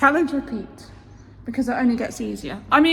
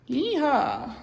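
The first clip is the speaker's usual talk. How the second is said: cowboy voice; 4 dB quieter than usual. Pretend. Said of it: "talking like a cowboy all of a sudden".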